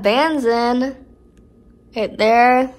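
lips stretched by finger; talking with cheek pulled aside; finger pulling mouth corner. Real, not acted. Seen 3: Finger hooks mouth corner, pulling it back to show braces while speaking.

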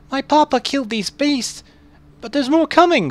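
put-on high, loud frightened child; a scared kid warning; young boy voice. Voicing the boy.

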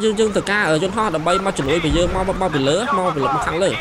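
ordinary talk

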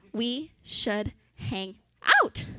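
slow, savoring each word. Shown as a demonstration. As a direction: let it sink in slowly.

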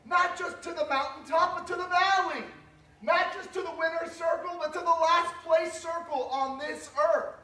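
high, loud, driving sermon climax; full-force preaching. Real, not acted. Pastor rallying the congregation at the climax, loud and high. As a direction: build and punch each not just.

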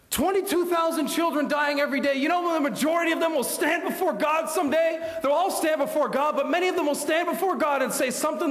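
preaching again. Acted. Preacher urging the congregation about children before God, urgent. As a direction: lift it back up and press.

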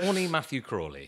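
loud and jokey; playful exclamation. Real, not acted. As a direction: announce it with a grin. Jokey loud self-announcement.

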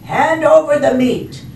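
loud, high-pitched demand; pirate demand. Acted. Braidbeard demands food at dinner, loud. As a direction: demand it like a rowdy captain. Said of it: "gulped his food and said".

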